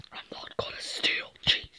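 hushed whisper so nobody wakes. Pretend. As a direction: breathe the words without voice. Whispered pretend bedtime chat.

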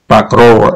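pa, rô said slowly, syllable by syllable, unstressed o as a; syllable demo. Shown as a demonstration. A commentator teaching viewers the Russian vowel rule syllable by syllable. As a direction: spell the sounds out: pa, then rô. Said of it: "tức là".

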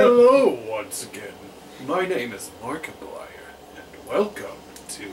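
booming hello, then a hushed, breathy intro; exaggerated YouTuber impression, big opening falling away; Markiplier. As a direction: burst in loud, then drop to a dramatic near-whisper. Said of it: "How did I do with that?".

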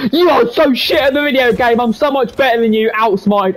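high, heated trash talk; angry gloating. Real, not acted. Gamer taunting a beaten opponent, angry gloating. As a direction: crow over a beaten rival at full tilt.

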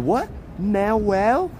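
"Manuel" garbled as na-WELL, rising high; confused mispronunciation. Retold or imitated. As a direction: puzzle over "Manuel" as nah-well, like a question. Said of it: "They're like".

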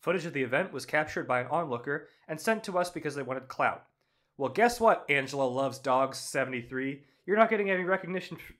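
ordinary talk

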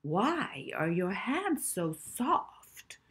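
slow, crooning mock commercial voice; playful mock advert actor. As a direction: ham it up like a cheesy ad. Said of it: "pretending in one of their favourite TV adverts".

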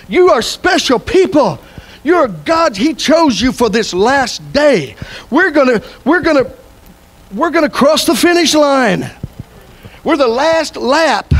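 high, slow emphatic proclamation; rally the congregation with drawn-out stresses; rousing preaching. Real, not acted. Preaches at full force.

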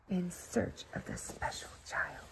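soft unvoiced narration; hushed storyteller whisper. A narrator's reading. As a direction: whisper it as they tiptoe off. Hushed end of scene.

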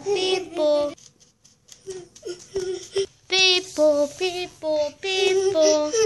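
loud ambulance siren; siren imitation. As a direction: wail like an ambulance.

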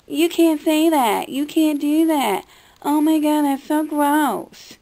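exaggerated mock disgust; mock scolding. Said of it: "and people are like".

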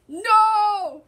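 high, loud cry of no; dismayed shout. Real, not acted. Player reacting to the finals, loud dismayed shout. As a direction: cry out in protest.

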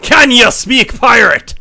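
slow, high Scottish pirate accent. Shown as a demonstration. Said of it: "That sounds so Scottish".

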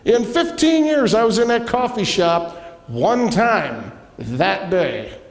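high, slow, soaring preacher's build; preaching at full force. Acted. Pastor preaching to his church, slow and soaring high. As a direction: lift the congregation, stretching each word.

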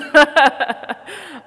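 high, loud burst of laughter; real self-deprecating laugh. Real, not acted. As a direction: laugh out loud at your own fumbling. Laughs at own pronunciation trouble.

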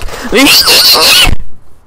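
wished-for voice sound. Shown as a demonstration. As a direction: let out a piercing high squeal. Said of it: "I wanna make my voice like".

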